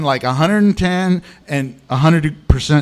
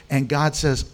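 ordinary delivery

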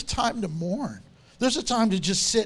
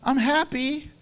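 mock cheerful; 5 semitones higher, 3 dB louder than usual. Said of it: "don't you go".

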